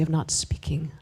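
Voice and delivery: whispered punchline. Acted. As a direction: hush it like a rule no one says aloud. Joke about the unspoken policy.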